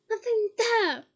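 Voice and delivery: high, loud shout. Acted. Harry shouting at Bellatrix. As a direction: yell it across the hall. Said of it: "he shouted".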